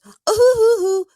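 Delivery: high hammed-up mock sobs; loud fake boo-hoo wails, way up high; fake crying. Recapping a reality show, mocking a cast member's fake sobbing. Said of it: "fake cries".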